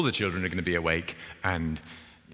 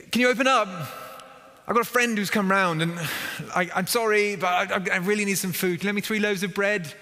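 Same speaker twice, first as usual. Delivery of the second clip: high, loud desperate plea at the door; pleading knock; 10 semitones higher, 3 dB louder than usual. Acted. Villager begging a sleeping neighbour for bread, high pleading call.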